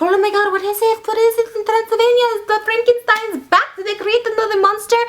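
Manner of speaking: high-pitched townspeople in a flap; mock alarm. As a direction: chatter in hammed-up alarm. Acting alarmed townspeople gossiping.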